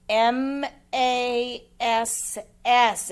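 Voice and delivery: slow letter names in "M A S S", each set apart; spelled out. Shown as a demonstration. Teacher checking pupils' work, "M A S S" spelled slowly.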